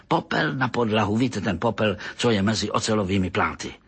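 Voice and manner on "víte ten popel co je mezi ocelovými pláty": ordinary delivery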